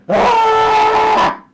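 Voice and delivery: high, loud hammed-up anguished scream; mock wail. Mocking complainers, loud hammed-up anguished scream. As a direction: shriek like a martyr. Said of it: "turns out to be".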